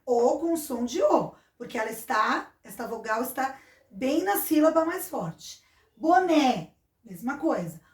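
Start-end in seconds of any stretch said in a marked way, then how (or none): 6.0-6.7 Boné said loud, last syllable strongest; stress on final NÉ. Shown as a demonstration. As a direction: punch the open é ending Boné. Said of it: "O E é a sílaba mais forte". (Boné com força na última sílaba, né)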